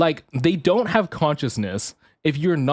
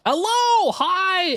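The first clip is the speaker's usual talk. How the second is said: much higher, a bright chirped hello; squeaky greeting; much slower than usual. Real, not acted. Streamer greeting a new chatter, high squeaky hi.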